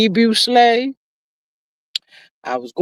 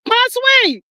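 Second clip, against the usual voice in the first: high cooing; 13 semitones higher than usual. Real, not acted. Cooing to chat member.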